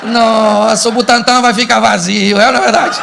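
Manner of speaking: loudly ironic exclamation; heavy irony. Acted. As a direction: ham up the sarcastic husband. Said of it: "cuidado com a ironia".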